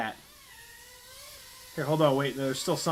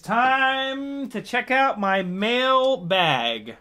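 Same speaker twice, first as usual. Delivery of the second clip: high, slow, stretched-out fanfare; showy segment announcement; 9 semitones higher, 4 dB louder, much slower than usual. Pretend. Host opening the mail bag segment, hammed-up announcement. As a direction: draw it out like a show intro.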